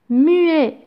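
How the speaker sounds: "muet" punched high and loud; stressed key word. Shown as a demonstration. Teacher driving home the silent H. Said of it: "c'est un H".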